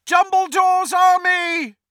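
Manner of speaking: high, full battle shout; shouted rallying cry. A narrator's reading. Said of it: "he shouted".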